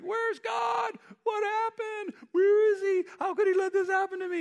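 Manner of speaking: high, loud panicked calling out; a frantic lost soul crying to God. Retold or imitated. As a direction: wail like someone lost and scared.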